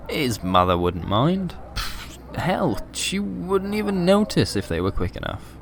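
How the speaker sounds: ordinary delivery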